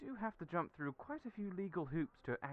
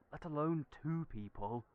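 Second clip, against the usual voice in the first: ordinary delivery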